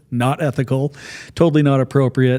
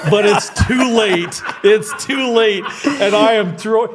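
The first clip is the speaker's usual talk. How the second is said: slow, higher, laughing dismay; laughing panic; 8 semitones higher, slower than usual. Retold or imitated. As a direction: relive the horror while cracking up. Prank hits the wrong man.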